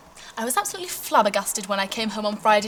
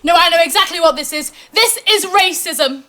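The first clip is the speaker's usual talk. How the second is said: indignant accusation; 4 semitones higher, 6 dB louder than usual. Acted. Mother accusing the teacher.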